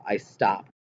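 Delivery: ordinary delivery, nothing marked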